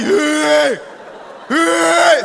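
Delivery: loud, high, stretched alarm wail; vocal imitation of a siren-like alarm; imitated alarm ringtone. Shown as a demonstration. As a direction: blare like a phone alarm. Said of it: "Moi, j'ai un hué".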